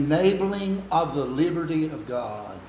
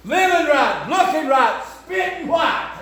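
high, loud, slow preaching cry; preaching at full force; 11 semitones higher, 6 dB louder, slower than usual. Real, not acted. Preacher calling young people to purity, full-force shout. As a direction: ring it out over the congregation.

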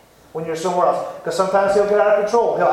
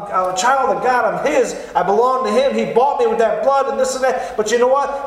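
ordinary talk, nothing marked

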